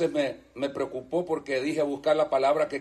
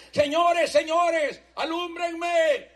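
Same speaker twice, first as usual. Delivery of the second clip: high pleading cry, dragging out alúmbrenme very slowly; preacher voicing the terrified jailer; desperate jailer; 10 semitones higher, much slower than usual. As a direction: beg for light in sheer panic. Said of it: "entra el hombre desesperado corriendo".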